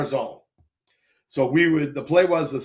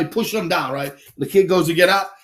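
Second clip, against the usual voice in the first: ordinary delivery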